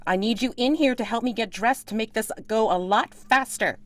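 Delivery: high, loud commanding woman's voice; bossy lady calling. Acted. Lady ordering her hired man in to help her dress, high and bossy. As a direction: order him about from behind the curtain.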